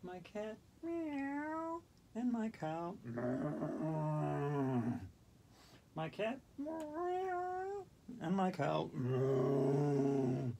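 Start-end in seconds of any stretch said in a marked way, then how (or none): 0.8-1.8 high playful meow; silly high cat meow. Pretend. Cat pose, imitates cat. 3.0-5.0 mock moo as a meow; cow meow joke. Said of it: "that's the noise they make". 6.6-7.8 stretched very high cat meow; high slow playful meow. Pretend. Cat pose, meow again. 8.9-10.5 low drawn-out meow for a cow; mock moo as a meow; deadpan low meow; cow meow joke. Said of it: "that's the noise they make".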